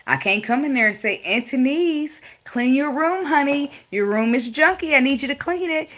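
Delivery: lightly voiced sweet-talking mama; a hint of mama asking nicely; mama's sweet voice. Mama sweetly asking the kids to clean up. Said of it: "She used to say".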